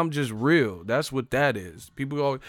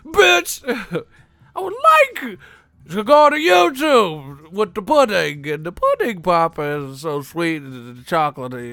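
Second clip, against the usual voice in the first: high, loud squeaky mock Cosby; Bill Cosby voice; 6 semitones higher, 8 dB louder than usual. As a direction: ham up a shrill pudding-pop celebrity voice. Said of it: "you are not Bill".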